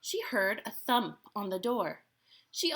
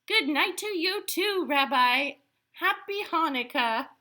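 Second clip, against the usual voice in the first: high, loud call of goodbye; cheerful farewell; 7 semitones higher, 5 dB louder than usual. Acted. Baba Braina sees the rabbi off into the night, calling cheerfully. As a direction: call a happy goodbye after a departing guest. Calls farewell to departing guest.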